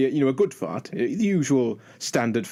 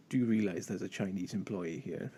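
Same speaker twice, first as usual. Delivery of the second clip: much softer, lower reproach; quiet scolding; 4 semitones lower, 11 dB quieter than usual. Retold or imitated. The lady friend telling Dan off at the restaurant, in a soft, low scolding murmur. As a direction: murmur it like a girlfriend telling him off. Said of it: "it's just like".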